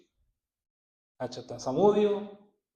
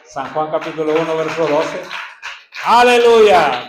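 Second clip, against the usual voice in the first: preaching at full force; 16 dB louder than usual. Acted. Preacher announcing the verse to the congregation, shouting. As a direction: proclaim it to the whole church.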